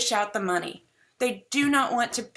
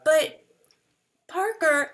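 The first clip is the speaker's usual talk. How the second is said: high imitation of a child; young son; 7 semitones higher, 4 dB louder than usual. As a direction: pitch it up like a protesting kid. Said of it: "And then he goes".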